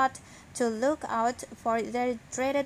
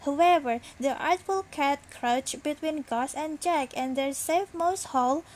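ordinary delivery

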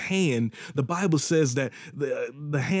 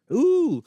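excited ooh; 12 semitones higher, 5 dB louder than usual. Real, not acted. Excited preacher exclaims.

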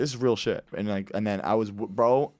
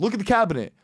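high, loud command; mock angry order; 8 semitones higher, 5 dB louder, faster than usual. Older brother luring kid to the cabinet, mock angry order. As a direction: order him over like a cross brother.

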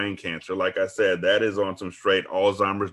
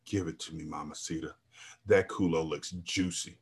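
low, hushed lustful voice; growled; 4 semitones lower, 10 dB quieter than usual. A narrator's reading. Juan demanding from the narrator, low growl. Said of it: "licked his lips and growled".

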